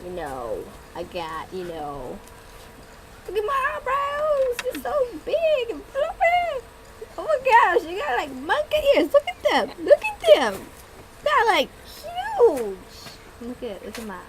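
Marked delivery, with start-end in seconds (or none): 3.3-6.6 much higher, playful show-off voice; gushing over her own eyebrows; excited showing off. Pretend. Vlogger showing off her eyebrows, high excited gush. 7.2-12.8 higher and louder, mock amazement; excited gawking. Teasing a friend about monkey ears, loud amazed squeal.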